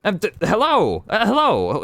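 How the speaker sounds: high, fumbling hello; flustered greeting. Acted. Brother greeting sister back home, high and fumbling.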